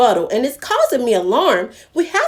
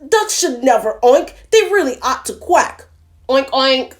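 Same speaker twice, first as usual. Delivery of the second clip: sputtering pig; 3 semitones higher, 4 dB louder than usual. Acted. Pig scolding the duck, loud and snorty. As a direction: splutter it like a cross pig. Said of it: "the pig just sputtered back".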